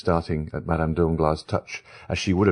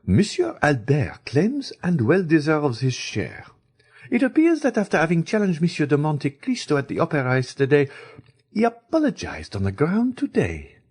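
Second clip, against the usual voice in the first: implacable; 10 semitones higher than usual. A narrator's reading. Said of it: "the implacable young girl".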